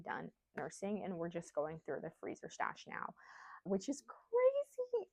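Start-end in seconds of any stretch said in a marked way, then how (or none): 3.6-5.1 high, drawn-out disbelief; amazed. Real, not acted. Mother marveling that nursing is over, amazed. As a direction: stretch it out high and slow in amazement.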